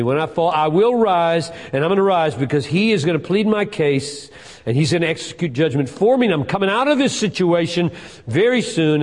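loud, driving declaration of faith; defiant preaching. Acted. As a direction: preach it straight at the enemy. Preaches defiant speech to the devil.

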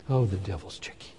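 normal talking voice

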